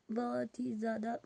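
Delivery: ordinary talk, nothing marked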